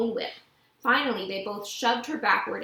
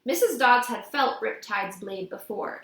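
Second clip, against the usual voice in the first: usual speaking voice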